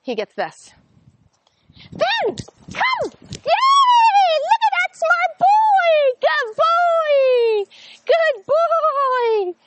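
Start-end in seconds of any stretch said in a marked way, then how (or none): 1.9-9.5 high, loud, gushing praise for the puppy; happy tone of voice. Shown as a demonstration. Trainer calling the puppy in, high happy excitement. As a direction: call him in with big happy excitement. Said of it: "happy tone of voice".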